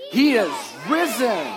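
loud, joyful proclamation. Shown as a demonstration. Pastor leading kids in Easter call, loud and slow. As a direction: proclaim it like a shout of joy. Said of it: "I wanna hear you just practice".

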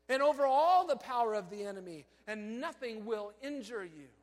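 loud, high, driving sermon voice; loud impassioned preacher building the point; full-force preaching. Acted. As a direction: preach it out to the whole hall. Preaching on Luke ten.

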